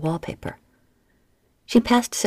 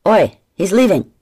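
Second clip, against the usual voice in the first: louder, higher urgent call; 4 semitones higher, 9 dB louder than usual. Acted. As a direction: rouse him sharply. Waking shipmate, alarm.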